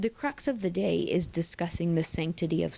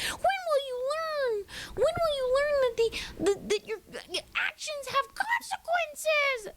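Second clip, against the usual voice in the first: shrill scolding diva. Retold or imitated. Scolding Melanie in a shrill, much higher voice. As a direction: wail it out like an exasperated diva. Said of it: "That's how I feel about Melanie".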